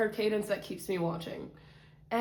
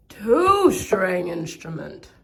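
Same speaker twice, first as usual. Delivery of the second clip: much louder, drawn-out count; louder and slow; 9 dB louder, slower than usual. Real, not acted. Showing instruments to viewers, loud and slow. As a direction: announce it slow and big.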